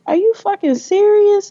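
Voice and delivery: high, incredulous outburst; stunned disbelief. Retold or imitated. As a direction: relive the moment the news hit. Retold reaction to cancer odds.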